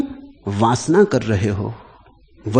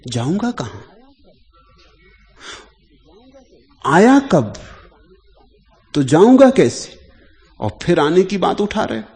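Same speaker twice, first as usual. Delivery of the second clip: raised, high-pitched acted questions with long pauses; incredulous retort; 8 semitones higher, 7 dB louder than usual. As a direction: retell it as astonished rhetorical questions, louder and higher. Acts out dying Raman's incredulous reply. (अचरज भरे सवाल, ऊंची आवाज़ में)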